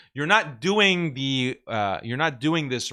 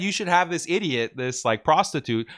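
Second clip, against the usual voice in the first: normal talking voice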